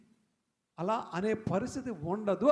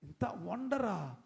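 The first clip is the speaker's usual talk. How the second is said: awed Naaman; 2 semitones lower, 7 dB quieter than usual. Retold or imitated. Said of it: "అతడు ఆశ్చర్యపోయాడు".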